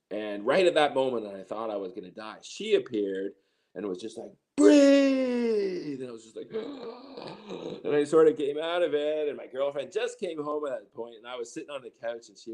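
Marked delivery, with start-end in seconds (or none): 4.6-6.0 high, loud urgent shout; shouted command. Retold or imitated. Elder commands him in crisis. 6.5-7.8 sudden high gasp for air; sharp gasp. Retold or imitated. Coming out of suffocation.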